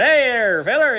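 calling out. Retold or imitated. Marcel calling the fruit vendor over on the train. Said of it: "he called that feller over".

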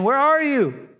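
acted, mild calling-out. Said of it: "He calls out".

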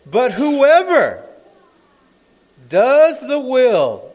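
loud, very slow, drawn-out preaching; forceful scripture proclamation. Acted. Preacher proclaiming First John to the church, loud and slow. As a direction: proclaim each word with great weight and long pauses. Proclaims the verse to close the point.

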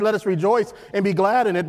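usual speaking voice

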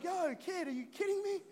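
casual Jesus. Retold or imitated. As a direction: tease the scared kid. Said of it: "And he's like".

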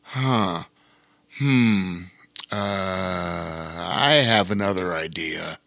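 low, slow pondering bear; patient bear king. A narrator's reading. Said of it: "Bear King listened patiently and then said".

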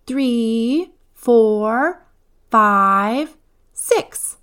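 high counting, each number lifting; child counting. A narrator's reading. Eva counting fingers, high rising child voice. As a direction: count up on fingers like a little girl.

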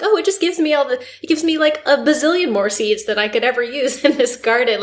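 high, delighted exclamation; excited high voice. Retold or imitated. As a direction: squeal it out with delight. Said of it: "I was like".

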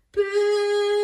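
high, loud sung pooey. Shown as a demonstration. Storyteller leading children in a sung pooey. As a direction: sing out a disgusted pooey. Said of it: "Can you do pooey with me again".

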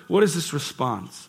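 ordinary talk, nothing marked

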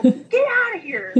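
high-pitched retold order, half laughing; mock yell. Doctor sending chattering staff out, mock yell. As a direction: imitate shooing a crowd out. Doctor clearing a noisy room.

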